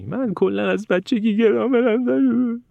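high, slow, drawn-out fake wail; mock crying. Student giving up in a corner, mock crying. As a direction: imitate a learner blubbering in self-pity.

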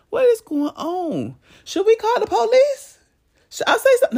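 a neighbour peeking out at a fight outside, fretting in a high voice; nosy neighbour. Acted. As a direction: squeak it out like a busybody at the window. Acting nosy neighbour watching fight.

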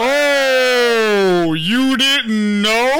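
high, loud, slow teasing sing-song; mock innocent. Real, not acted. Teasing about surprise marriage.

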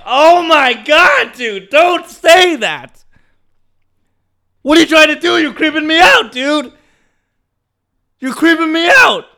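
acted-out appalled squeal; creeped-out protest. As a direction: plead with him to stop, voice way up. Creeped out by passenger, retold.

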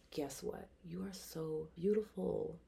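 put-on soft, gentle talk to a child; slow down softly and reassure a little one; tender to child. Woman at eye level with little girl, tender and soft. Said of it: "I immediately get to eye level".

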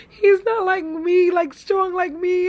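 the words catch as tears come; voice breaking, crying. Real, not acted. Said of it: "you guys have me up here, crying".

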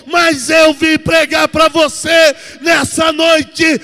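very loud, building Pentecostal proclamation; thunderous pulpit climax with drawn-out phrases; full-force preaching. Acted. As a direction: declare victory to the congregation, stretching key words.

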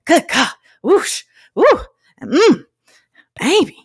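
put-on high, loud whooping old man; a startled old dad's high whoop; dad exclaiming. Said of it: "He was like".

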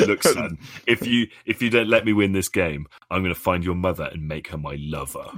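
low, fast fake British Indian accent. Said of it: "fake British Indian accents".

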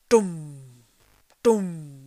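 loud, booming drum sounds; the deep drums of Moria, booming; booming drum beats. A narrator's reading. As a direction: boom it out like war drums. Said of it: "went the drums in the deep".

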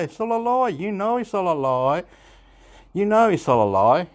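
ordinary talk, nothing marked

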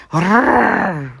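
loud rolling growl; big dog growling. A narrator's reading. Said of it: "morogta a kutya".

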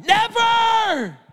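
high, ringing cry of refusal; defiant shout. Acted. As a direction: fling the word out in protest. Peter's defiant denial.

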